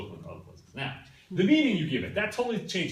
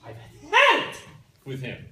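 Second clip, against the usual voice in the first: a sudden high loud burst of frustration; exasperated outburst; 13 semitones higher, 13 dB louder than usual. Retold or imitated. Wife fed up with her absent husband, loud exasperated outburst. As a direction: blurt it out like you're fed up. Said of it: "and you say".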